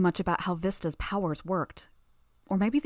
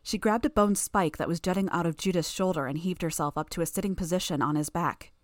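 ordinary delivery, nothing marked

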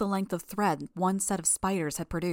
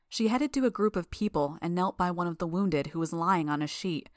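plain reading voice

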